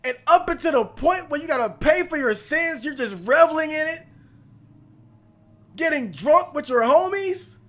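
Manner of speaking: building from loud indignation to a high furious shout; furious rant. Real, not acted. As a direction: yell at the traitor, real rage. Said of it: "I'm mad".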